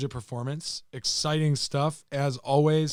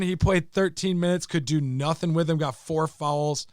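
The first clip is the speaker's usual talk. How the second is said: ordinary talk, nothing marked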